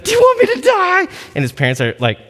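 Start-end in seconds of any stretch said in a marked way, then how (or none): 0.0-1.1 put-on high, loud panicked child's wail; mock panicked little boy, much higher and louder; whiny kid crying for help. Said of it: "He's like".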